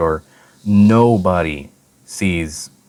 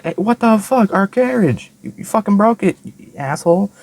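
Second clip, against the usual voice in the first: high shrill yelling mockery; hammed-up high angry yell; mock angry ladies; 14 semitones higher, 4 dB louder than usual. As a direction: yell like furious old ladies.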